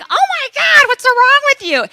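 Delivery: high-pitched, loud alarm; shocked flight attendants. Retold or imitated. Flight attendants react to runaway passenger, shrill shock. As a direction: cry out aghast, voice way up. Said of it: "the flight attendants are like".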